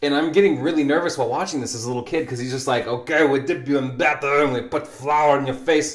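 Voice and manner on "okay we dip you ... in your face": attempted French chef coming out in an Italian accent; cartoon chef with a thick Italian accent. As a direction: put on a hammy Italian accent. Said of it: "that was my best Italian accent".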